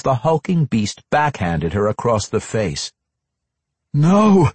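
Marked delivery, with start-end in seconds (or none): 3.9-4.5 sharp high desperate cry. A narrator's reading. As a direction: shout it out in despair. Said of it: "Entreri cried out despite himself".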